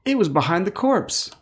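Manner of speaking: put-on high, bright kids' show host voice; Dora the Explorer. Shown as a demonstration. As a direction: ask like a cartoon guide to children. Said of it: "like Dora the Explorer".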